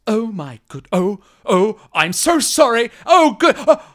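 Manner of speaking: high, mounting panicked apology; flustered apology. Acted. Milos freed from the thrall, high flustered apology. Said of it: "looks around and says".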